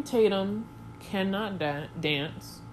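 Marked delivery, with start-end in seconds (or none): none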